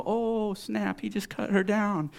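high, gossipy reaction; playful high-pitched jeer; mock shock. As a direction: react like a scandalized onlooker. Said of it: "we look at that and go".

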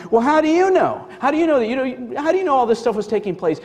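quick, agitated demand; challenging questioners. Acted. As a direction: fire the questions off in protest. Acted challenge to Jesus in argument.

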